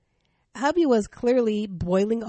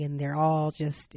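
normal talking voice

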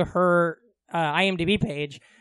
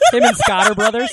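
laughing while asking; 3 semitones higher, 10 dB louder than usual. Real, not acted. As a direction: squeaks out through a laugh.

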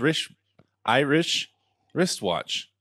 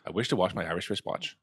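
quick, softer run through the tongue twister; said fast; 3 semitones lower, 6 dB quieter, faster than usual. Shown as a demonstration. Showing friends it must be said fast, rapid-fire. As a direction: rattle it off lightly without stopping. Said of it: "You gotta say it fast".

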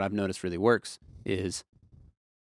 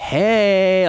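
loud, high mock holler; calling out; 10 semitones higher, 11 dB louder than usual. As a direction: imitate a musician hollering across a stage. Musician calling to busy engineer.

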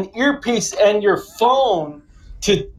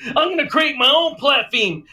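heated rant repeated; 6 semitones higher than usual. Retold or imitated. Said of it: "he goes like this".

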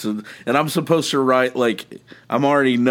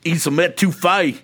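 high, hammed-up Cajun Louisiana accent; mock a Louisiana local, pitched up; 7 semitones higher than usual. Podcaster joins in the skit jokes, mock Louisiana accent. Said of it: "they all do this Louisiana accent".

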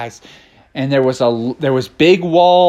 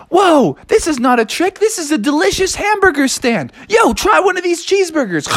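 excited eaters; 13 semitones higher than usual. Acted. Animals at burger stand.

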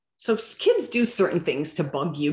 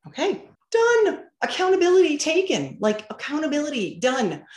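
upbeat praise; 10 semitones higher, 4 dB louder than usual. Retold or imitated. Praising son's accountability.

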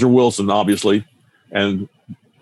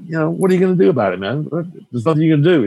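exasperated retort; 6 semitones higher, faster than usual. Retold or imitated. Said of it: "I said".

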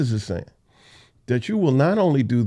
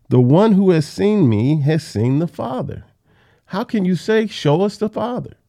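higher-pitched exasperated insistence; 7 semitones higher, 4 dB louder than usual. Acted. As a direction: press the point with pained disbelief. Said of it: "he's exasperated in his tone".

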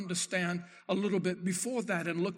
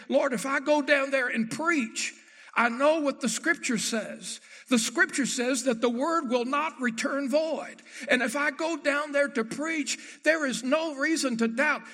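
preaching at full force; 5 semitones higher than usual. Acted. Preacher voicing Jonah's stubborn complaint to God, rising. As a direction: preach Jonah's refusal with fire.

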